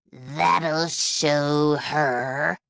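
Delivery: slow low evil witch cackle; cackling witch. Acted. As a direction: gloat it out slowly like a witch. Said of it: "the witch cackled".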